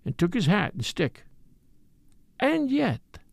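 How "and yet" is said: put-on high, musing accented voice; murmured to himself. A narrator's reading. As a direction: think aloud on a higher, lingering note. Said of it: "he murmured to himself".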